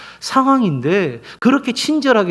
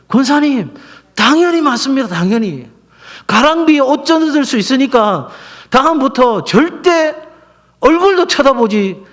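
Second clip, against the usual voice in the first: higher-pitched, insistent retold advice; emphatic assurance; 7 semitones higher, 4 dB louder than usual. Pastor retelling his phone advice to a church deaconess, emphatic assurance. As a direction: press the warning home with conviction.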